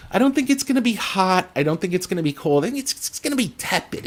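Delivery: a high, strained comic voice, quick on the last line; a half-done comedian impression; Gilbert Gottfried bit. A streamer riffing on a market forecast, comic impression. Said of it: "It's like a Gilbert Gottfried bit".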